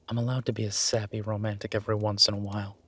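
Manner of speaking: usual speaking voice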